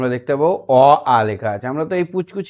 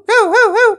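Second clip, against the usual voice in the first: much higher squeaky little puppy barks; puppy yap; 6 dB louder than usual. Shown as a demonstration. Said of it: "বাচ্চা কুকুর". (বাচ্চা কুকুরের মতো সরু ঘেউ ঘেউ)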